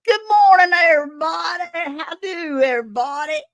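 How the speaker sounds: voicing a viewer's comment in a high, loud, whooping voice; hammed-up viewer greeting. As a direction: belt it out like an overexcited fan. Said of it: "And Lazy Days Ahead says".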